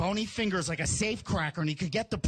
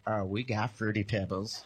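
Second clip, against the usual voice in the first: put-on very low, confidential kid voice; knowing little brother; 12 semitones lower than usual. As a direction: imitate a boy letting out a secret. Brother revealing he knows a secret.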